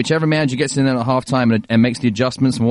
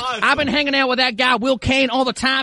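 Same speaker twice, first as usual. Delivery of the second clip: high, hammed-up Texas twang; Texas accent; 8 semitones higher than usual. Host asked for Texas tells Charlie not to worry, Texas accent. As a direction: put on a Texan accent, pitched well up. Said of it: "Yeah, do a Texas accent".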